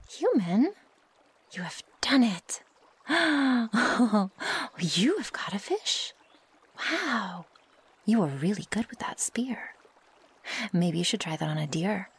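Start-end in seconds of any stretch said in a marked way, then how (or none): 0.1-2.6 higher, astonished rising cry; amazed. Acted. Wolf to human who just speared a fish, amazed. 3.1-4.7 high, loud astonished gasps; delighted gasp. Acted. Wolf sees the human's catch, delighted gasping. 4.8-7.5 slow, swooping wonder; awed amazement. Acted. Wolf praising human's first spearfishing catch, amazed.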